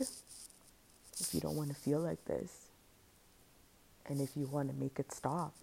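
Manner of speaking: quiet, measured inner tempter; inner dark voice. Retold or imitated. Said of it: "and then that voice came".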